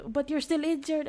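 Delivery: high, loud hammed-up fretting; mock worried girl. Girl fretting over the injured defender, hammed-up high. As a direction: overplay her worry. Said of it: "she's like".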